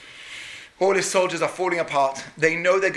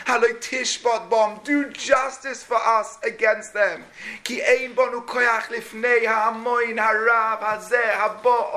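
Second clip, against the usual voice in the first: raised, slow, pleading plea to God; pleading prayer; 6 semitones higher than usual. Acted. King praying before the fasting city, pleading and raised. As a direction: beg God for rescue, voice lifted high.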